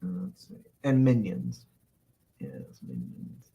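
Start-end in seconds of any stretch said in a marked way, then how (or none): 2.4-3.5 slow, very low near-whisper; hushed, scheming. Pretend. Streamer dreaming of a castle with minions, hushed and slow. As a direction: savour it under your breath. Savouring his wish for minions.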